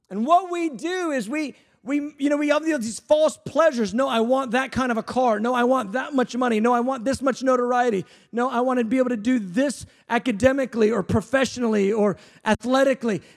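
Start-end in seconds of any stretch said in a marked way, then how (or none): none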